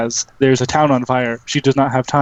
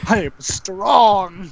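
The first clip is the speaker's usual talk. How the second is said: high, drawn-out boast; mock triumphant boast; 6 semitones higher, much slower than usual. Game master's character boasting of strength, drawn-out mock triumph. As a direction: stretch it out high and proud.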